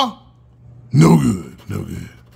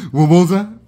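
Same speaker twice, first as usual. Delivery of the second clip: louder, pressing the word "more"; louder insistent repeat; 8 dB louder than usual. Real, not acted. Repeating himself to the ticket, louder and insistent. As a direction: say it again, louder and pushier.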